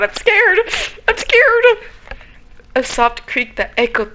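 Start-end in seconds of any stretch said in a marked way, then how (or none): 0.0-0.6 high, loud frightened cry; scared. Real, not acted. Horror game jump moment. 1.1-1.6 high, loud frightened cry; scared. Real, not acted. Horror game jump moment.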